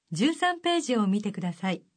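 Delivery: ordinary talk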